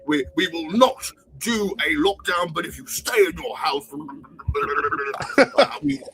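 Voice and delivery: bumbling, stuttering politician; Boris Johnson. Acted. Prime minister talking lockdown at home, bumbling. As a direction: stumble over the pledge and bluster onward. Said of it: "can you do Boris Johnson".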